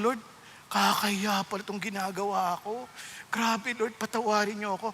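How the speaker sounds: hushed, low plea for forgiveness; softly confess, voice dropped right down; retold penitent prayer. Preacher recalls praying while reading the Word, hushed and penitent.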